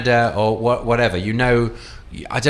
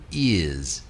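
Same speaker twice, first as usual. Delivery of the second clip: posh British "ears" for yes, lower; Prince Charles; 5 semitones lower, 4 dB quieter than usual. Shown as a demonstration. Prince Charles answers about polo, posh British accent. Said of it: "the word ears for yes".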